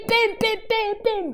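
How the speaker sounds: high, loud playful bonks; bim hammer noises. Acted. Teacher mimes whacking goblins, loud playful bims. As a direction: whack each bim like a mallet.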